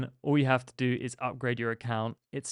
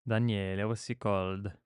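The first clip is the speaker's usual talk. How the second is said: put-on Italian accent; 3 semitones lower, 6 dB quieter than usual. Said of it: "do the Italian accent".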